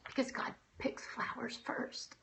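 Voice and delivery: soft, slow whisper of comfort; gentle whisper. Retold or imitated. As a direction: breathe it tenderly like a secret. Lady's condolence at the funeral.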